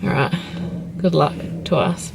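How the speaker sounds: usual speaking voice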